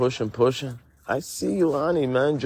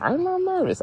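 denial jumps up into a much higher voice; high-pitched voice; 4 dB quieter than usual. Real, not acted. Insisting to Annie he isn't nervous, in a high voice. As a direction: say it pitched way higher than your own. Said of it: "talking with a high-pitched voice".

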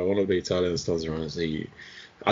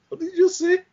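very high, stunned disbelief; shocked retort; 3 dB louder than usual. Retold or imitated. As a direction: fire it back in shock, pitched way up. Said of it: "I looked at him and said".

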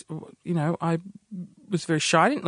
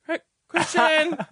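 high, drawn-out call for the husband; pretend wife calling; 8 semitones higher, slower than usual. Fake wife summoning husband to the phone, calling out. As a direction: call out slowly in a put-on high voice. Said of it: "I'll get my husband".

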